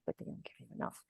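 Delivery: breathy whispered aside; hushed, unvoiced confiding whisper. Real, not acted. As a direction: drop to a whisper for the complaint. Painful procedure, voice drops.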